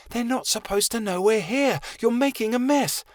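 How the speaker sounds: put-on high, bright young girl voice; lightly voiced young girl asking; girl character voice. Chameleon's character voice.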